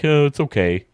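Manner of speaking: lightly voiced indifferent viewer; a hint of a shrugging fan; mild mock shrug. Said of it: "people who are like".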